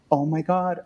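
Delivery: a touch of giddy wonder, slowing down; starstruck awe. Retold or imitated. Finally seeing [name] onstage.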